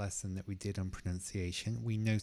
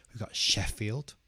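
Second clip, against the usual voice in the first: ordinary delivery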